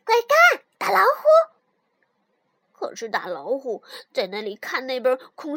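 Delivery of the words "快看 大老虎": high, loud excited call. A narrator's reading. Proud mouse showing tiger the castle, excited shout. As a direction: call out proudly to get a friend's attention. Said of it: "就喊老虎".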